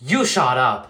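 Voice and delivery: usual speaking voice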